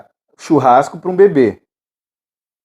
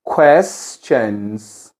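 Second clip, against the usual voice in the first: QUESTIONS drawn out in two parts, QUES-TIONS; slow word modelling; 6 semitones lower than usual. Shown as a demonstration. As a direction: say "Questions" slowly and lower, syllable by syllable. Teacher models new word for repetition. (pronúncia lenta, sílaba por sílaba)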